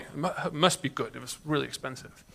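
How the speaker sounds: imitating a buyer quietly reassuring himself; muttered aside. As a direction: toss it off under the breath, mock-justifying. Voicing buyers' faith as aside.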